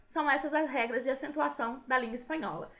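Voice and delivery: ordinary talk, nothing marked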